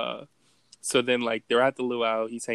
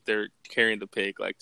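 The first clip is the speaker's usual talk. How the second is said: ordinary talk, nothing marked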